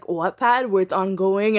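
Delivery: usual speaking voice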